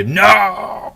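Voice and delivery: high, loud despairing no; a defeated enemy crying out as he explodes; sharp, high, anguished protest; villain's dying cry. Acted. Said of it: "you just hear".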